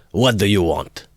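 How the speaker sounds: barked out, high and loud; shouted. A narrator's reading. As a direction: snap the question at the ragged fellow. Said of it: "he shouted".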